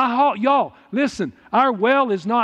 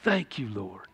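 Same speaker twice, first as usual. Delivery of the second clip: breathless whispered oh's of praise, much softer; 10 semitones lower, 14 dB quieter than usual. Retold or imitated. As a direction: whisper it as if overcome by the Spirit. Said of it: "It's like".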